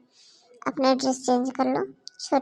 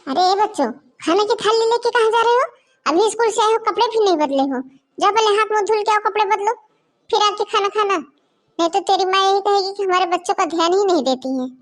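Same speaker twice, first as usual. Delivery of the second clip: high, loud scolding of the grandchildren; 4 semitones higher, 5 dB louder than usual. Acted. Grandmother scolding the children as they carry food, loud. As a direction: scold them sharply, voice up.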